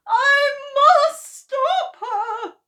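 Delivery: high, very slow, loud tearful wail. Acted. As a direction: draw out the wail in a high, crying voice. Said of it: "Missus Cranky wailed".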